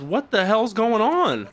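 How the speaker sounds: high, slow bewildered question; lost and shocked; a confused viewer reacting; baffled. Real, not acted. Confused by the scene.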